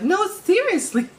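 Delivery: very high, slow, loud girl imitation; exasperated girl. Man imitating a girl who won't believe him, exasperated high voice. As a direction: insist in a drawn-out mock girly squeal. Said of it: "She's like".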